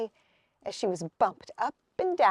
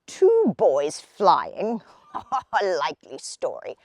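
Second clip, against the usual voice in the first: scoffing laugh; 7 semitones lower than usual. A narrator's reading. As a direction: laugh off a silly tale. Old man scoffs laughing.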